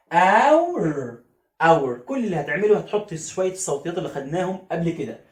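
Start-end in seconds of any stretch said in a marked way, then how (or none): 0.1-1.2 اور drawn out very slowly; stretched ow. Shown as a demonstration. Teacher repeating the ow sound. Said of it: "تاني".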